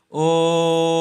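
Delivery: ओम with the ओ held very long and steady; chant-like prolonged ओम; drawn-out om. Shown as a demonstration. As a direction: hold the ओ in ओम three times as long. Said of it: "ओ को तीन गुना समय में बोला जाता है".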